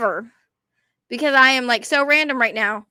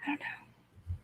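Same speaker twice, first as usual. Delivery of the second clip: barely audible whisper; tiny, hushed aside after embarrassing yourself; whispered apology; 25 dB quieter than usual. Real, not acted. Sheepish aside after bad accent.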